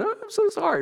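very high whimpering fake cry; mock tearful pleading. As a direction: plead in a high, hammed-up sob. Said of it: "you begin to let the waterworks flow".

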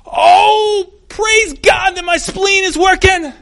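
high, loud, slow sarcastic gushing; mock cheerful praise. Mock praise after hitting a finger, high and hammed-up. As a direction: ham up absurd cheerful praise. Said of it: "you didn't hit your finger and go".

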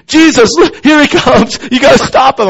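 high-pitched comic cry for help; hammed-up frightened plea; mock panic. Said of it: "if I saw him running my way".

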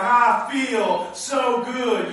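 very slow, drawn-out contented sigh; mock bliss. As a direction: savor it like a fake high. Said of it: "you're like".

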